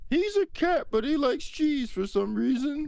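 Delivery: high sing-song doting voice; high cooing pet owner voice. Pretend. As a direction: coo about your pet rat like a proud owner. Doting owner voice about rat.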